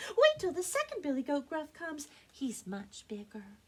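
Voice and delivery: high, eager little goat; small goat voice. Acted. Smallest goat bargains with the troll, small high voice. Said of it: "in his very small voice".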